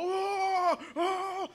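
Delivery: high battle cry; soldiers shouting. Retold or imitated. Pastor imitating soldiers with a high battle cry. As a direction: roar it like troops before the charge.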